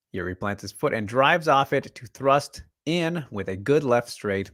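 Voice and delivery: steady narration read; reading a script aloud. Acted. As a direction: read it like a voiceover. Reads Ghost's breakdown script.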